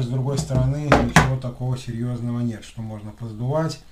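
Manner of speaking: ordinary delivery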